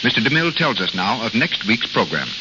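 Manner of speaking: usual speaking voice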